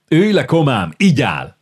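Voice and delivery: officer calls his friend to sit, hearty loud greeting; jovial call. Acted. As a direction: greet an old comrade loudly.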